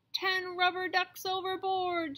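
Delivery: high alarmed captain call; shouting captain. Acted. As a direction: call it out over the storm. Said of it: "shouts the captain".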